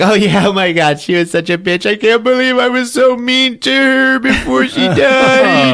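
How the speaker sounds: put-on high, loud wailing teenage regret; distraught teen. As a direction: gush it near hysterics. Girl wails about her dead mother.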